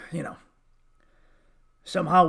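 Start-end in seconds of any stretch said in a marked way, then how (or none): 0.1-0.4 unvoiced whispered aside. Real, not acted. Host whispering an aside to the audience. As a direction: drop to a whisper.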